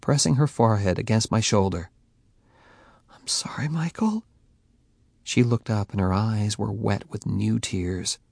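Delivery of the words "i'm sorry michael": whispered, tender sorry; soft apology. Acted. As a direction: soften and apologize gently. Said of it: "Her demeanor softened".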